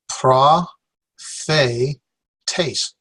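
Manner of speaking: "Pra, fe, taste" in three separate beats; syllable by syllable. Shown as a demonstration. Teacher sounding out for class, "Pra... fe... taste" separately. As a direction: pause after each piece: prah, fay, tays. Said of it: "three syllables".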